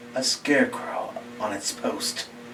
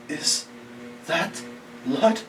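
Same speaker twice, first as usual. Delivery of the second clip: halting, mildly frightened question; in terror; 3 semitones higher, slower than usual. Acted. Joe seeing red smudges on the window, halting terror. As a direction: break it word by word in dawning dread. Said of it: "in terror".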